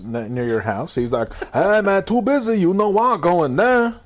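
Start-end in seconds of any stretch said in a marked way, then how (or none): 1.5-4.0 high, loud mock barrio accent; Ichiro. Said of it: "you sound like you're from the barrio".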